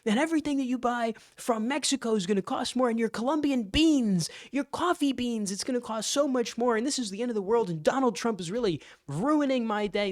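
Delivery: high, whiny mockery; the left complaining about tariffs, high mock whine. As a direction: whine like a melodramatic critic. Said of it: "hoping that the United States".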